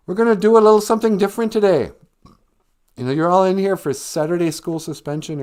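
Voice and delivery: fast, loud, high lecturing principal; mean principal. Retold or imitated. Principal assigning the detention essay, loud and bossy. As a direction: lay down the law with mock authority. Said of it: "And he goes".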